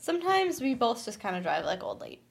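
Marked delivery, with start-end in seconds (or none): 1.0-2.2 hushed joking aside; whispering. Real, not acted. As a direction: whisper it as a confession.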